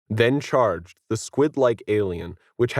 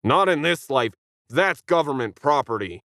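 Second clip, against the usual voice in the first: higher, mildly raised shout; 5 semitones higher than usual. A narrator's reading. Ken refusing the alien, mild shout. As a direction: shout defiantly. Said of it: "Ken shouted".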